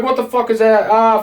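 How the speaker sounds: sudden high-pitched cry of disbelief; shocked outburst. Retold or imitated. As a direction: jolt up high as the monster appears. Monster rises from swamp water.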